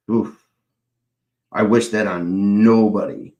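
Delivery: ordinary talk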